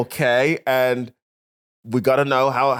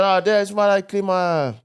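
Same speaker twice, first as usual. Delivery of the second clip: higher, slow, badly faked Birmingham; attempted Birmingham accent; 6 semitones higher, slower than usual. Imitating a former workmate, attempted Birmingham accent. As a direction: have a shaky go at a Birmingham accent. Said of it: "I'm trying to do Birmingham".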